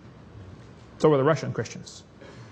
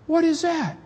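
amazed Rebs; 12 semitones higher than usual. Acted. Rebs crowd around the prisoner.

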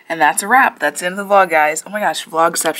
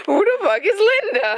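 mock shriek; 13 semitones higher than usual. Mock quarrel echo.

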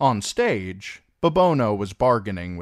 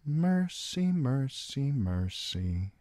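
fading repetition; 7 semitones higher, 4 dB quieter, slower than usual. Acted. Mercy steeling herself to act.

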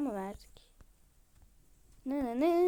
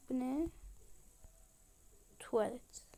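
ordinary talk

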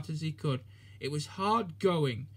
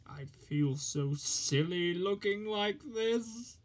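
slow, higher sulky complaint; complaining; 4 semitones higher, slower than usual. Acted. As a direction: whine it out slowly, embarrassed. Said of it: "complained Henry".

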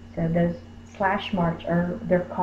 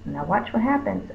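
ordinary talk

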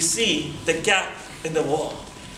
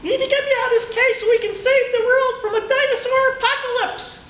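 high, cartoonish little monkey voice; talking monkey; 5 dB louder than usual. Caged monkey begging the boy to free him, high voice. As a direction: squeak it out like a tiny caged monkey. Monkey explains his mission.

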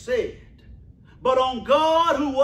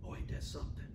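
whispered wonder to the church; soft whispered marvel; hushed aside; 19 dB quieter, much faster than usual. Real, not acted. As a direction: breathe it like a secret.

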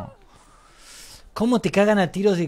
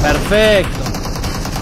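long, loud stretched "perfecto"; drawn-out cheer; 6 dB louder, much slower than usual. Real, not acted. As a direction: stretch out "perfecto" loudly and slowly. Gleeful cheer after a kill.

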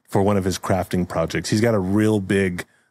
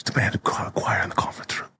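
scandalized whisper, much softer; shocked whisper; 5 dB quieter, faster than usual. Acted. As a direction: whisper it as if appalled.

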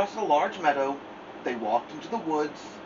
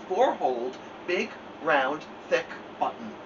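plain reading voice

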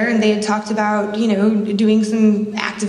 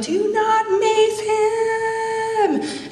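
long drawn-out yell, much higher; yelling at the top of her lungs; 11 semitones higher, much slower than usual. Retold or imitated. Protester yelling at the officer about to mace a man, long high yell. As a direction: stretch every word into a high desperate yell. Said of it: "at the top of my lungs, I just yelled".

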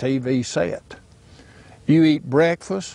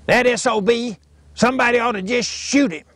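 daddy's voice; 10 semitones higher than usual. Retold or imitated. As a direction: bark it out like a bigoted old Alabama daddy. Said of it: "Jimmy Hope's daddy says".

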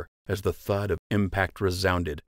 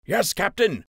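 higher eager shouted reply; Nate shouting; 12 semitones higher, 3 dB louder than usual. A narrator's reading. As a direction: shout back to the captain. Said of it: "Nate Flynn shouted".

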